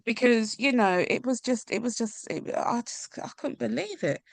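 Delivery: high, strained voice near crying; real emotion pushing the voice up as she tries not to cry; holding back tears. Real, not acted. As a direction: fight the tears and keep talking. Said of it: "I try not to cry again".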